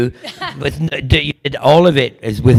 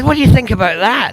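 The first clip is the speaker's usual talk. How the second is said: tag; 8 semitones higher, 4 dB louder, faster than usual. Retold or imitated. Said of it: "he said".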